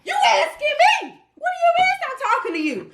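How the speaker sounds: high, laughing outraged question; mock indignant yell. Woman replaying snapping back at her boyfriend, high and outraged. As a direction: yell it back at him in disbelief. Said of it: "I'm like".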